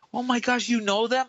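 high, awed disbelief; starstruck colleague. Retold or imitated. Colleague realizing he knows celebrities, high awed gasp. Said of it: "she goes".

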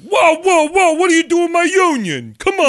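loud, high New Jersey union guy; Jersey accent. Pretend. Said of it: "Now we can switch to the Jersey accent".

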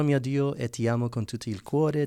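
normal talking voice

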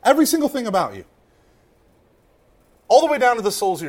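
fast rush through "Every single thing about you"; rapid repeat. Shown as a demonstration. Preacher to congregation, repeating the line fast. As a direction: tumble the words out quickly. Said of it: "I don't know why I'm yelling".

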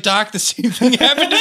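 high-pitched mock bawling as Luigi; mock tearful outburst. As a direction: wail it like a comic character bursting into tears. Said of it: "Luigi bursts into tears".

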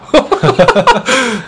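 high bright laugh; burst of laughter. Real, not acted. Host laughs at interview idea.

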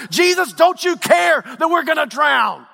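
high, urgent cry of reproach; desperate accusing shout, preacher-style; accusing indictment. Acted. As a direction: demand an answer as if about to drown. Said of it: "they indict him".

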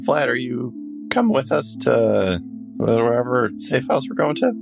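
normal talking voice

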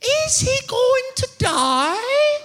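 put-on high, very slow, tearful child voice; crying little boy. Son asks if his father will die, high tearful child. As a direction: stretch it out like a kid about to cry. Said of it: "He says".

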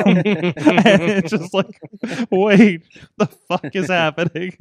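laughing hard while talking; can't stop laughing. Real, not acted. Retelling, breaking into laughter.

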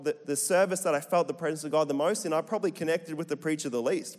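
ordinary talk

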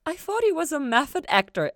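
high, loud sheepish admission; rueful admission. A narrator's reading. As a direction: confess it with a wince. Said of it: "Ella admitted".

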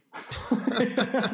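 a burst of high, amused laughter. Real, not acted. Runner laughing at the farmer naming a fierce dog Fluffy, high laugh. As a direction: laugh out loud at the silly name.

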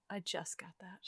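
breathy whisper of I just got that. Real, not acted. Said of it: "repeats as whisper".

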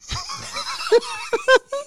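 high-pitched burst of laughter; big laugh. Real, not acted. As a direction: crack up at a funny story. Cracking up at the story.

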